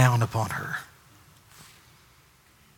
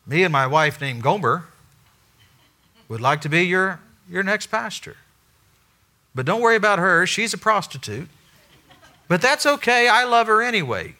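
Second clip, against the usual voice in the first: higher, hurried pitch to committee; nervous candidate; 8 semitones higher, 3 dB louder than usual. Retold or imitated. Hosea pitching to pastor search committee, higher voice. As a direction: sell it awkwardly. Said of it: "pass that by the search committee".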